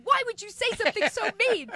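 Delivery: high, loud wounded outrage; imitated angry yelling. Widow reproaching comedian over the joke, imitated angry yelling. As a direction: act out her shouted reproach. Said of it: "she's yelling at me".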